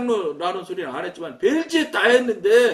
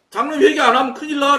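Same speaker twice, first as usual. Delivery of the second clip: retold loud warning; 6 semitones higher, 7 dB louder, faster than usual. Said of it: "내가 그랬지".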